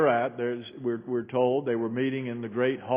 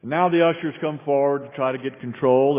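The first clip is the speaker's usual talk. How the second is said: ordinary talk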